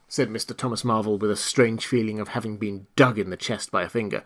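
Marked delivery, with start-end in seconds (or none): none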